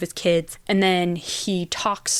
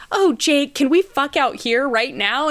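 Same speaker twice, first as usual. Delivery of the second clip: eager gush; 7 semitones higher, 4 dB louder than usual. Retold or imitated. Banner voiced eagerly in retelling.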